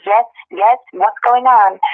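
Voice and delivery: ordinary delivery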